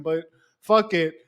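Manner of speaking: ordinary delivery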